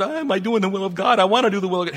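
hammed-up fretful striving; mock fretting. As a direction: act out anxious over-eagerness. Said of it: "fuss and fight and stretch and worry".

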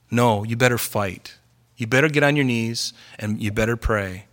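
normal talking voice

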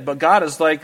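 ordinary talk

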